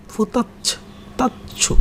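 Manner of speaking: whispered syllables, practising the Japanese word ফুতাচ্ছু. Shown as a demonstration. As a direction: whisper ফুতাচ্ছু softly, as if rehearsing the sounds.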